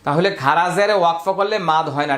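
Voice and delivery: ordinary delivery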